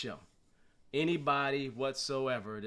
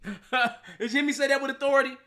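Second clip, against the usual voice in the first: laughing; 9 semitones higher, 5 dB louder than usual. Real, not acted. Laughing at own put-on voice.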